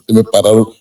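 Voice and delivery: usual speaking voice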